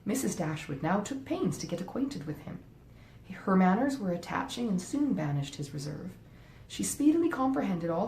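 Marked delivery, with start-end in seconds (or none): none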